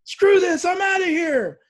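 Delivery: high, loud defiant yell; re-enacted screaming. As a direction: shout it as if bolting from the room. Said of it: "like, screaming".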